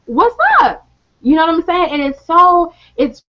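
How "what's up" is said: high, challenging retort; confrontational what's up. Retold or imitated. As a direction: snap it back like a dare. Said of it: "I'm just like".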